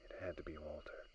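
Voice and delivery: soft, stammering fearful whisper; shaken whisper. Acted. Survivor finds a crewmate's skeleton, whispering in dread. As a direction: barely get the words out.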